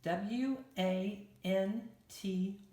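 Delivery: letters "W A N T" said one by one, slowly; slow letter-by-letter spelling. Shown as a demonstration. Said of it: "So we're gonna".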